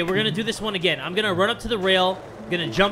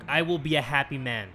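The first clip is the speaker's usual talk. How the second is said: normal talking voice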